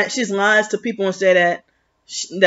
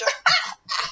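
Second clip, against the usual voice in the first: very high, softer mocking cackle; mimicked fake laugh; 6 dB quieter than usual. Showing how she does her laugh, mocking and fake. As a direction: squeal out an imitation laugh. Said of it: "she does her lip song like".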